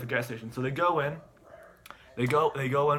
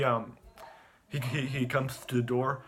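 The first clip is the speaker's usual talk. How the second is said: ordinary delivery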